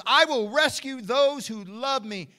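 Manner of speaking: loud, high, impassioned proclamation; full-force preaching. Real, not acted. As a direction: cry it out over the congregation. Declaims scripture at full force.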